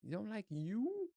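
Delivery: high-pitched disbelieving what; mock shock that anyone dislikes it; incredulous. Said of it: "I'm like".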